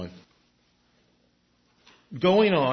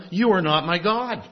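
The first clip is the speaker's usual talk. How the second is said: higher, defiant voice of a people turning away; defiant Israel rejecting God; 6 semitones higher than usual. Retold or imitated. Preacher voicing Israel telling God it has other gods, defiant and higher. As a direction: toss it off like someone done with God. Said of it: "they have said to him".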